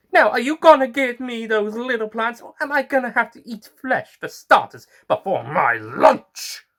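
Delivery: high, loud menacing plant monster; threatening flytrap. Acted. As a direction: threaten them like a hungry beast. Flytrap threatens to eat flesh.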